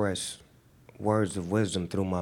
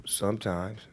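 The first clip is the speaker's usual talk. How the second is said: usual speaking voice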